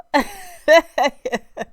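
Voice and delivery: a loud happy laugh bursting out; loud burst of laughter. Real, not acted. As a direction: let a full laugh burst out. Laughs greeting viewers.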